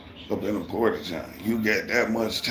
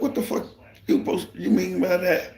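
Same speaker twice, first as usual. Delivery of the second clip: high, fast, half-breathed demand; indignant challenge; 12 semitones higher, 4 dB louder than usual. Retold or imitated. Demanding the boss explain himself, high and fast. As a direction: press him hard for an answer.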